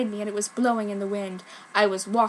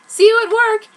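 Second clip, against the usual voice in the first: higher and louder, bright farewell; cheerful goodbye; 11 semitones higher, 11 dB louder than usual. Acted. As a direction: wave off a friend happily.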